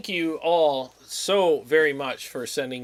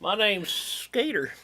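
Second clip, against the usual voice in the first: Skeeter voice; 6 semitones higher, slower than usual. Acted. Introduces character Skeeter.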